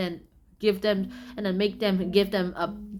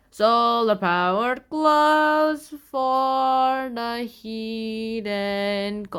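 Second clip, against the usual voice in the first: very slow, drawn-out announcer voice; announcer title; 4 dB louder, much slower than usual. Acted. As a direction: stretch the title out slowly. Title card read.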